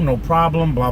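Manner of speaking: ordinary delivery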